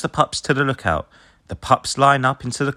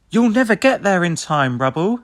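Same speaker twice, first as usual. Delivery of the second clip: much higher, louder alarm; urgent warning; 10 semitones higher, 4 dB louder than usual. A narrator's reading. Ryder warning Rubble, higher and louder. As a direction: shout a warning. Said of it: "says Ryder".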